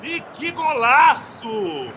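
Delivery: shouted goal call, long held vowels; explosive goal commentary. Acted. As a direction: yell the goal, then savor it. Goal call by play-by-play narrator.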